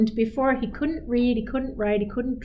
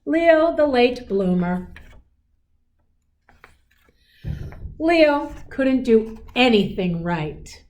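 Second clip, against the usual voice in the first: storybook narration; 5 semitones higher, 3 dB louder, slower than usual. A narrator's reading.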